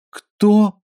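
high, baffled squeak; puzzled cry; surprised. A narrator's reading. Bewildered question.

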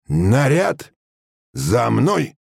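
high military call, rising on the first word; drill command. A narrator's reading. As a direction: summon the squad to follow. Sergeant gives drill command. (строевая команда)

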